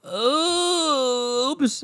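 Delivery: long high-pitched "oops"; oooops, held high in fake innocence; mock oops. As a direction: draw out a high, mocking oops. Mocking her hypocrisy.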